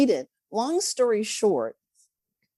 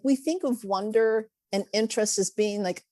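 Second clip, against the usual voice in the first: normal talking voice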